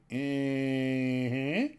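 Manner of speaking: long drawn-out "eh", rising at the end; uneasy eh. Acted. Reacting to talk of using Vaseline, uneasy drawn-out eh. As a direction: stretch out a doubtful "eh" that lifts into a question. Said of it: "I'm kinda like".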